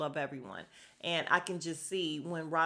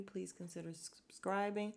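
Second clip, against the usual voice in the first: garbled slip; 9 dB quieter than usual. Real, not acted. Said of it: "I don't know what I just said".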